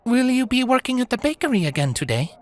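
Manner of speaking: high, quick, curious voice; curious young woman. Acted. Tukey asks Diana about the bakery, curious. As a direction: ask it lightly and brightly.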